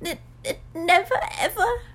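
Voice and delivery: high, stammering elf sob; overwhelmed, crying little elf; tearful wail. A narrator's reading. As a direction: choke it out in tears, pitched high. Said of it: "the elf burst into tears".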